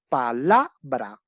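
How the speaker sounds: "Palabra" slowly syllable by syllable, LA punched; stress on LA. Shown as a demonstration. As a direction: break up "Palabra" as pa-LA-bra, stressing the middle. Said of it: "¿Dónde ya lo voy a ofrecer vos?".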